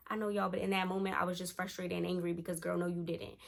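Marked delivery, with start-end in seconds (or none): none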